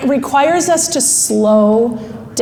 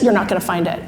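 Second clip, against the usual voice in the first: low, matter-of-fact voice of reason; level, lowered, certain; thinking brain; 7 semitones lower, 4 dB quieter, faster than usual. Acted. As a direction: break the bad news calmly. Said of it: "Thinking brain finally says".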